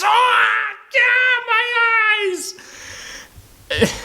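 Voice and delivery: high, shrill-pitched mock scream of pain; hammed-up falsetto wail; mock screaming henchman. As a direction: squeal it like a hurt thug. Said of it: "screaming things like".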